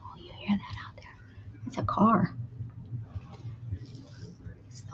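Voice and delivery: hushed unvoiced whisper. Real, not acted. Owner whispering to cats about scary cars outside.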